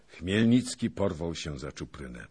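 ordinary delivery, nothing marked

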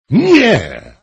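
tender cry. A narrator's reading. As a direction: cry out Nie to her tenderly. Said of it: "zawołał król czule". (król woła czule, głośno i wysoko: Nie)

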